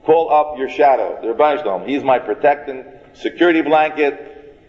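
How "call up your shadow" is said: usual speaking voice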